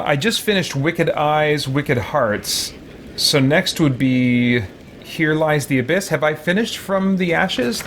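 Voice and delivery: ordinary delivery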